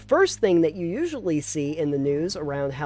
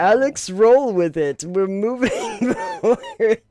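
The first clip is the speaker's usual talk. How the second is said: laughing through it; 8 semitones higher, 7 dB louder than usual. Real, not acted. Laughing, holding spooky voice.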